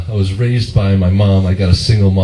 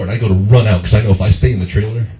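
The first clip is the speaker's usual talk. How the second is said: usual speaking voice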